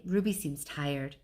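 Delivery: ordinary delivery, nothing marked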